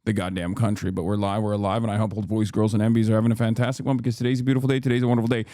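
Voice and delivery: rapid-fire streamer intro; show-opening patter. Real, not acted. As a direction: rattle off the welcome in one rolling stream. Opens the live broadcast.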